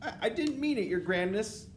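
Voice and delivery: high, stammering apology; cowering underling witch; frightened cry. A narrator's reading. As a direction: plead in a high, panicked voice. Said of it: "the witch cried out".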